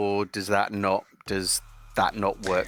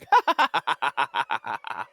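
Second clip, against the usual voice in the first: maniacal cackle; 4 semitones higher, 5 dB louder than usual. Acted. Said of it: "just going".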